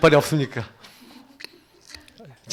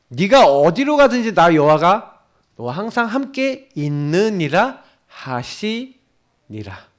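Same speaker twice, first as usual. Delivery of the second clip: slow, weighty scripture proclamation; slow solemn proclamation; 3 semitones higher, 4 dB louder, much slower than usual. Real, not acted. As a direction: proclaim each word slowly and solemnly. Preacher proclaiming God's promise. (천천히 장엄하게 선포하듯)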